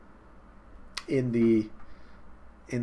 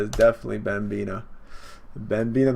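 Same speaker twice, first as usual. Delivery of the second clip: normal talking voice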